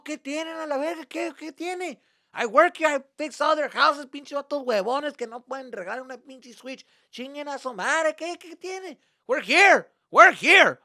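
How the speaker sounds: loud, high, indignant tirade; defiant dad rant. Retold or imitated. Dad insisting they're staying at the lake, loud and defiant. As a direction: rant back as a dad who won't budge.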